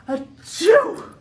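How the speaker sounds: loud, high hammed-up achoo; theatrical sneeze for the camera; mock sneeze. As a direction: fake a big sneeze. Acting out allergic sneezing.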